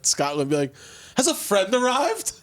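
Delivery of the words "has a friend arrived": high, drawn-out mock announcement; grand arrival announcement. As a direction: announce it slowly, pitched way up. Acting walking into Scottish bar.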